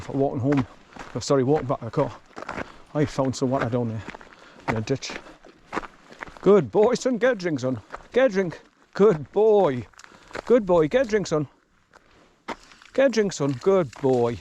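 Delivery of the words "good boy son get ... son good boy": talking to the dog. Real, not acted. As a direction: coo encouragement at a thirsty dog. Praising the dog at the water.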